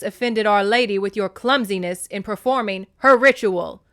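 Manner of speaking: loud, sharp scolding mother; mom voice. Acted. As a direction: snap it like a strict mom. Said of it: "I'm having to get into my mom voice there for Demeter".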